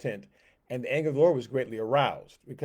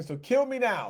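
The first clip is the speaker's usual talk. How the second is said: despairing plea; 9 semitones higher than usual. Acted. Elijah begging to die.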